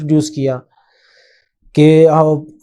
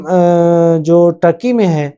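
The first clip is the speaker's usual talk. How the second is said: ordinary talk, nothing marked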